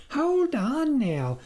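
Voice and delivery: high, slow, calming caution; slow him down gently. Acted. Wife to her despairing husband, slow caution. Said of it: "the farmer's wife cautioned".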